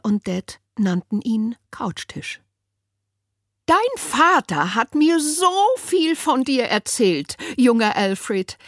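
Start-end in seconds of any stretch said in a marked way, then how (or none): 3.7-8.6 high, put-on gushing old lady voice; high sugary social worker voice; Winnie the social worker. A narrator's reading. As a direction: coo it like an overbearing visitor. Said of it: "sagte Winnie".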